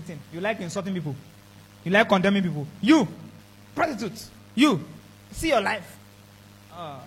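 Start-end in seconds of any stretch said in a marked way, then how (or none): none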